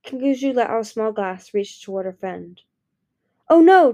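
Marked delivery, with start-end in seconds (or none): 3.5-3.9 loud, high panicked cry; frantic. A narrator's reading. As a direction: panic in your head. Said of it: "Turtle thought frantically".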